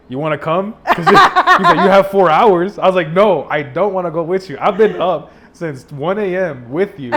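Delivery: can't stop laughing at the offer; laughing over the friend's invitation; laughing retelling. Real, not acted.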